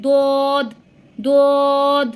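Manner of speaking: দোদ thick and firm from the tongue's side; heavy Arabic dad. Shown as a demonstration. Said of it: "জিহ্বার গোড়ার কিনারের সাইডটা".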